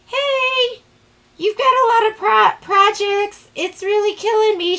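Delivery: high, loud whiny complaint to the rep; mock complaint. To her sales rep about too many projects, high loud whine. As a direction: whine it up high like you're begging for mercy. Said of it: "Every time I talk to my rep, I'm like".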